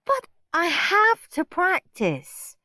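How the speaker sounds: high, slow sulky protest; moody whine. Acted. Margaret refusing Mum's request, high sulky whine. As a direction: whine it back at Mum. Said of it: "said moody Margaret".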